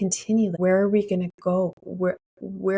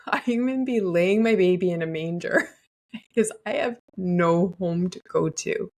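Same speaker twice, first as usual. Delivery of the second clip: laughing through words. Real, not acted. Joking about having no home.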